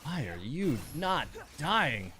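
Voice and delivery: ordinary talk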